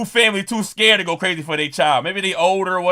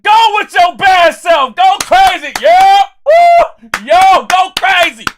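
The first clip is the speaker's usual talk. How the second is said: loud, high-pitched hollering cheer; cheering scream; 12 semitones higher, 9 dB louder, slower than usual. Retold or imitated. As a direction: whoop and holler like a parent at graduation. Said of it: "I was only gonna scream for my son".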